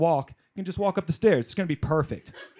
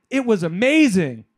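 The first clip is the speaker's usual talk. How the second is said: put-on gleeful kid tattling; 7 semitones higher, 5 dB louder than usual. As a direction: spill every secret like a delighted kid.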